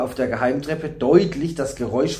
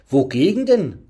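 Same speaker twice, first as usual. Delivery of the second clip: raised, questioning exclamation; calling out; 3 semitones higher, 5 dB louder than usual. A narrator's reading. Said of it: "rief Thuri". (fragend ausgerufen)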